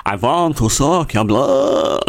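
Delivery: slow, hammed-up vampire accent; crap Dracula. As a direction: ham up a cheesy Dracula, drawing it out. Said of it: "Doing a crap Dracula impression?".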